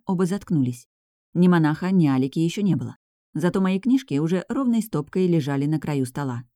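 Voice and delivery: plain reading voice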